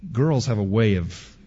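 ordinary talk, nothing marked

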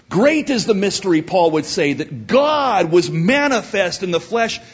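loud, high scripture proclamation; full-force preaching. Real, not acted. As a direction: proclaim it ringingly to the congregation.

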